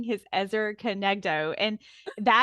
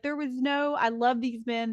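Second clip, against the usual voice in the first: ordinary talk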